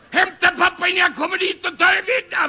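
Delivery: high-pitched exasperated shout; angry threat. Acted. Caller threatening child to fetch father, shouting. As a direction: yell a threat down the phone.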